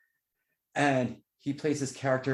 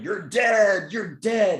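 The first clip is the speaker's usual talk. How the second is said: louder, higher mock yell of grief; imitating a vampire's shout; 8 semitones higher, 7 dB louder, much slower than usual. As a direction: shout it like the vampire mourning his friend. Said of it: "starts going".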